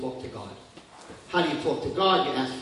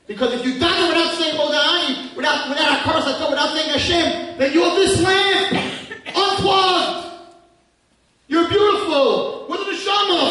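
high, loud, slow sung-out prayer; preaching at full force; 11 dB louder than usual. Acted. Preacher urging listeners to pray every morning, loud and high. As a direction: cry the words out to the congregation.

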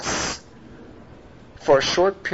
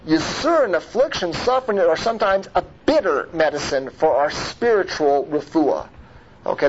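ordinary talk, nothing marked

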